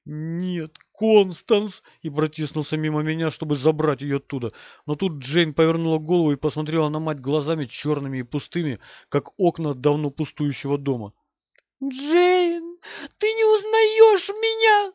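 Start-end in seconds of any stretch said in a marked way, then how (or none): 0.1-1.8 strained protest, jumping up on the name; forced out. A narrator's reading. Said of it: "Уолтер выдавил". 11.8-14.9 very high, loud, drawn-out whine; whining wail. A narrator's reading. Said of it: "заскулила Дж-, Констанс".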